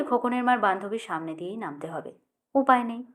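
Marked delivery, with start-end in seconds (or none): none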